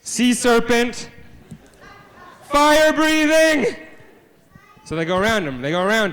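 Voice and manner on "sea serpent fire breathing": high, very slow, drawn-out yell; dramatic monster alarm. Acted. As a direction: stretch each word into a loud cry of alarm. Monster appears in story.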